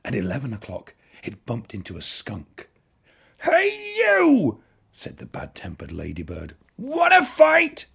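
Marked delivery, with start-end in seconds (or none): 3.4-4.6 challenging shout. A narrator's reading. Ladybird picks a fight. 6.8-7.8 challenging shout. A narrator's reading. Ladybird picks a fight.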